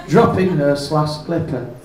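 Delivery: ordinary talk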